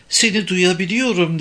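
high, lightly amused taunt; laughing tone. A narrator's reading. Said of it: "Gülen bir ses tonuyla".

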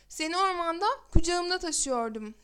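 ordinary delivery, nothing marked

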